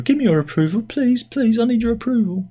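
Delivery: high, whiny hammed-up plea; begging viewers for approval in a high whine; mock begging. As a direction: beg like a desperate needy performer. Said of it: "hammed-up begging for likes".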